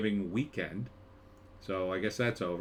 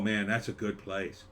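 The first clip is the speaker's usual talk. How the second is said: ordinary talk, nothing marked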